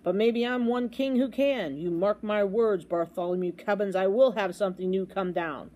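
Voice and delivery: plain reading voice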